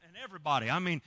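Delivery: ordinary delivery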